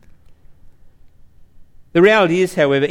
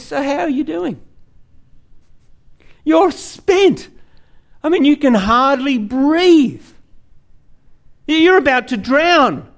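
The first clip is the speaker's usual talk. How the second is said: much higher, slower, gentle lifeguard voice; a lightly voiced calm man checking on you; calm rescuer; 8 semitones higher, slower than usual. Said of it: "And he just says".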